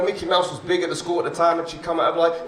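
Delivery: ordinary delivery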